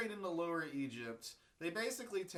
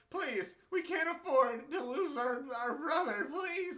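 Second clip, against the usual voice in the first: higher, louder hammed-up begging with a laugh; mock pleading; 7 semitones higher, 6 dB louder than usual. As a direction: beg like a brother in trouble, half laughing. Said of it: "they're like".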